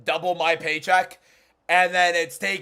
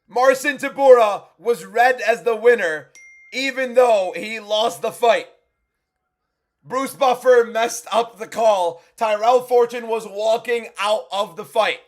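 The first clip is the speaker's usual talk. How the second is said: recap voiceover read; 4 semitones higher, 4 dB louder, slower than usual. Acted. Recap read for his video.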